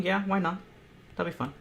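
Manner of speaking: ordinary delivery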